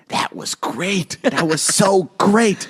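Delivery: slow, drawn-out mock praise; stretched-out gushing, imitating Jason; imitating Jason's thing. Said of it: "he does his thing".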